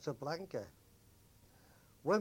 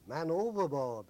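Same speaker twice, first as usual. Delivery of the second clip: calling out man overboard, loud; retold shout; 4 semitones higher, 8 dB louder than usual. Sailors shouting up to the bridge, loud call. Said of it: "we all shouted up to the bridge".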